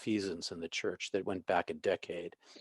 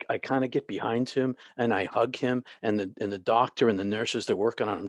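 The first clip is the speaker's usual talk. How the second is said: normal talking voice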